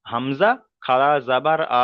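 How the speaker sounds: slow spelling chant, long আ at the end; measured, stretched syllable-by-syllable drill; drawn-out recitation. Shown as a demonstration. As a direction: hold the final আ one alif long. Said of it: "এখানে টানটা এক আলিফ দিতে হবে".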